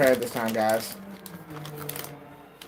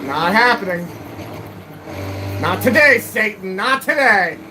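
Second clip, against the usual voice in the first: playful exclamation; 6 semitones higher, 4 dB louder than usual. Real, not acted. Fending off the cat.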